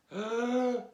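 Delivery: huge theatrical inhale; big breath in. Acted. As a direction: suck in air like a wolf about to huff. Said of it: "Can you do a big breath with me".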